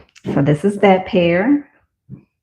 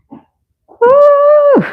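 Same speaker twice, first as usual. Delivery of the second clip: high, loud delighted woo; squealing woo of delight; excited whoop; 7 dB louder than usual. Real, not acted. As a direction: whoop with joy at the shoe. Admiring shoes on camera.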